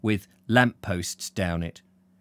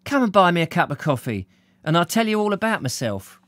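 perky blonde, pitched up; brightening up; 7 semitones higher than usual. Acted. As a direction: invite him along, perking up. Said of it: "brightening up again".

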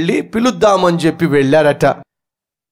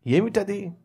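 calm husband; 10 dB quieter, slower than usual. Acted. Husband replying quietly.

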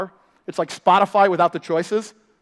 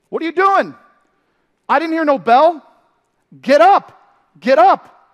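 Mickey yelling; 10 semitones higher, 11 dB louder than usual. Retold or imitated. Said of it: "say to him".

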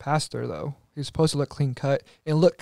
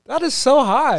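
surprised; 10 semitones higher, 5 dB louder, slower than usual. Real, not acted. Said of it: "Very generous".

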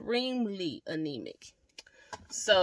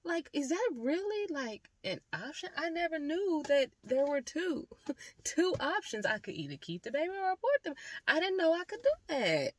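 shocked disbelief; 9 semitones higher than usual. Retold or imitated. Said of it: "And I was like".

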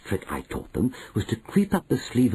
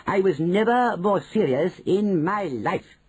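slow, higher, firm; grandmother insisting; 8 semitones higher, slower than usual. A narrator's reading. Grandmother to manager, slow higher firm insistence. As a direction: lean on each word, pitched up.

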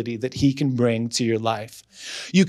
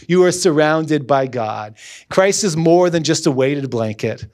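ordinary talk, nothing marked

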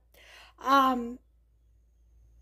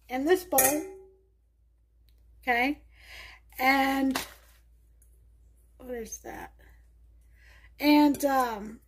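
normal talking voice

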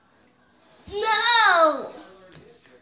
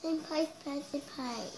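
softer, lower repeat of a name; much softer repeat; 6 semitones lower, 16 dB quieter than usual. Real, not acted. Child repeating their name for a parent, much softer. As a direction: say the name small and low. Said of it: "Say it slower".

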